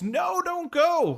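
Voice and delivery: high, slow mock yell, pitch jumping way up; retold yell. Woman in the circle begging her not to leave, high drawn-out mock yell. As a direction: stretch it out in a high dramatic cry. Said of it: "And then she yells".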